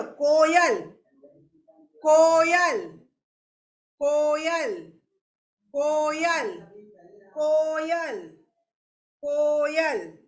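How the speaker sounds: "કોયલ" with a long, held "કો"; repeat-after-me drill. Shown as a demonstration. Teacher has pupils repeat the word, drawn-out "કોયલ". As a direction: stretch the first syllable of "કોયલ", then drop. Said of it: "તો બોલો".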